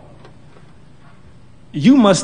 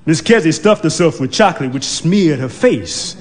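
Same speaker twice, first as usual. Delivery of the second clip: usual speaking voice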